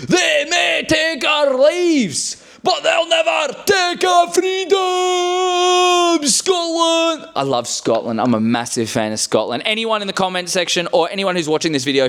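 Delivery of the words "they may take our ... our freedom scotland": much higher, very slow, shouted battle cry; Braveheart speech. Retold or imitated. Braveheart hero rallying his army, slow and shouting. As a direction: roar it like a warlord before battle. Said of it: "have you all seen Braveheart".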